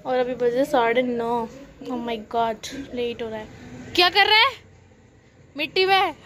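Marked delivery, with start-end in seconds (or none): 3.9-6.1 high, loud scolding; scolding call. Real, not acted. Aunt calling out to Keshu playing in dirt, loud and high.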